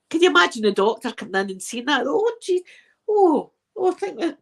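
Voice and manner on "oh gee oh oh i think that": high flustered stammer of surprise; mock shock. Doctor seeing man with trousers down, flustered high shock.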